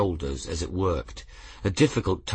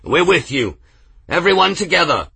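louder, higher rallying cry; rallying shout; 9 semitones higher, 7 dB louder than usual. A narrator's reading. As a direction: cheer the group into the fight. Dock worker rallying crowd.

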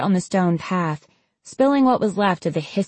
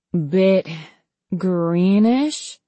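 groggy; much slower than usual. Acted. Drugged patient reports on his vision.

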